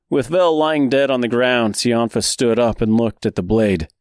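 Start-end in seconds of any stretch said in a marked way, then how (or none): none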